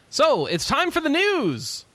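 drawn-out, high, sing-out announcer call; news segment announcement. Pretend. Host calls the panel to the news, drawn-out announcement. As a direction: stretch it out like a show host.

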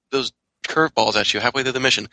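ordinary talk, nothing marked

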